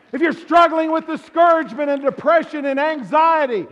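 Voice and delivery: rally the church with rising fervor; preacher crying out to the congregation; full-force preaching. Acted.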